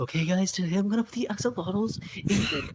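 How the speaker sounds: high, cutesy uwu YouTuber imitation; wholesome TikTok voice. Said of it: "ooh-woo wholesome TikTok voice".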